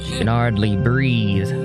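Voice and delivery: usual speaking voice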